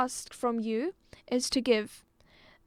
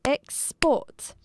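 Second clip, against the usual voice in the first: "export" beaten out as EX, PORT; two separated syllables on "export", as if clapping them; clapped-out syllables; 4 dB louder than usual. Shown as a demonstration. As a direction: say "export" in two distinct beats. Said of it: "it's just clapping to the beat".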